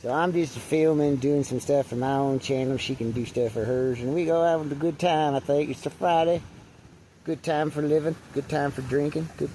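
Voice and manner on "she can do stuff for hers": normal talking voice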